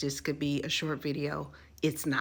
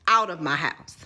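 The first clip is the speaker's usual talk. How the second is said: grandma's order; 8 dB louder than usual. Retold or imitated. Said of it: "Get that ninja".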